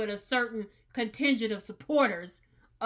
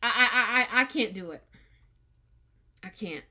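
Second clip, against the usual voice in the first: choked up; faster than usual. Real, not acted. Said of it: "before I get too, you know, upset".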